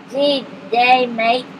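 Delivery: put-on light Australian accent on a friendly greeting; a hint of broad Australian accent, easygoing. A narrator's reading. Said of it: "broad Australian accent said".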